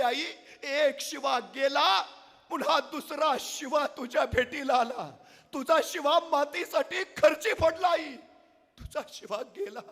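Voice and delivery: slow, high, loud mournful telling; king breaking a death to the mother; grieving king. Acted. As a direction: drag out the terrible news. Raje breaks the son's death.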